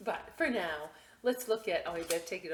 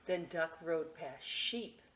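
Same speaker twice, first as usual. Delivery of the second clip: ordinary delivery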